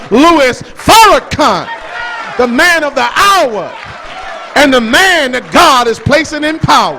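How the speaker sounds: loud, ringing pulpit cry; preaching at full force. Acted. Preacher proclaiming Farrakhan to the crowd, at full force. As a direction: build to a roaring climax.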